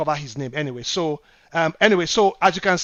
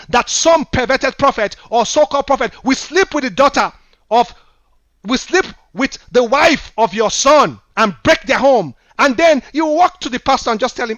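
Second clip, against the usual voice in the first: louder, higher fiery rebuke; emotional preaching; 6 semitones higher, 8 dB louder than usual. Real, not acted. Pastor rebuking churchgoers over a dead brother, loud and fiery. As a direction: thunder at the congregation like a grieving prophet. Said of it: "I'm quite emotional about this".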